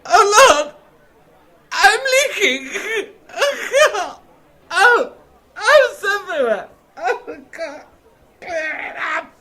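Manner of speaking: loud, high squeals of alarm; panicked high yelps. Acted. Drinker yelps at spilled cider, loud and high. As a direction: shriek as the cider spills everywhere.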